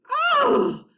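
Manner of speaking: very high yelp of shock; a kid's hammed-up shriek; shocked cry. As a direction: gasp it out in horror. Shock at the bus.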